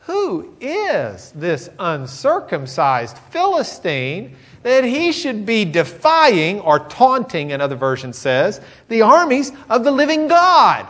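high, loud, slow and swelling; outraged at the insult to God; indignant outrage. Acted. David outraged at the Philistine's taunt. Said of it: "I love this".

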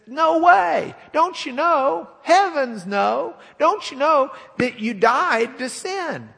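high, loud exasperated rebuke; Paul insisting, almost shouting; emphatic Paul. Retold or imitated. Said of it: "And he says".

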